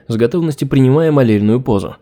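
ordinary delivery, nothing marked

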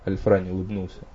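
ordinary delivery

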